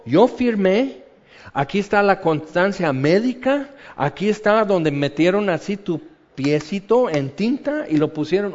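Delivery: ordinary delivery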